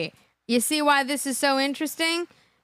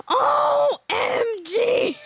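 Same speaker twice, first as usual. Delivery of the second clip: high, slow, spelled out letter by letter; drawn-out squeal of disbelief; shocked OMG; 10 semitones higher, much slower than usual. Real, not acted.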